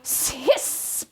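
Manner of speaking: soft, unvoiced hissing cat; breathy cat hiss. A narrator's reading. Cat fights on stage.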